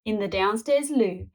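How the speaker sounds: ordinary delivery, nothing marked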